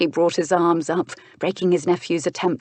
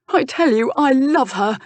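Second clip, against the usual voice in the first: higher, earnest lofty declaration; ridiculously noble; 8 semitones higher, 5 dB louder than usual. Acted. Lovesick nephew insists to his uncle, lofty noble declaration. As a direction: proclaim it with overblown nobility. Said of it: "He sounded ridiculously noble".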